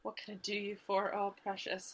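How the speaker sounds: quiet fond imitation; murmured echo. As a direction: murmur it low, under your breath. Quiet echo of Southern greeting.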